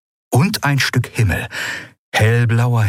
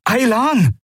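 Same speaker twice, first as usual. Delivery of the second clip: high urgent call of the name Aylan. A narrator's reading. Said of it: "rief Kai".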